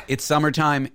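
usual speaking voice

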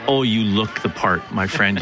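ordinary talk

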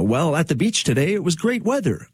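lilting, high-pitched mock anchor patter; chirpy news anchor. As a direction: mimic an anchor switching to beach weather. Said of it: "have a complete hard turn".

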